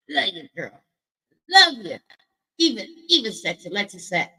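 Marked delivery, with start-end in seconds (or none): none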